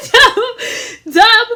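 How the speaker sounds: laughing through the name; name broken by a laugh. Real, not acted. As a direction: giggle out the name. Laughing while listing names.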